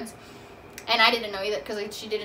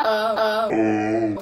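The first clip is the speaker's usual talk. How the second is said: startled oh; 2 semitones lower, 6 dB louder than usual. Retold or imitated. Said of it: "And I was like".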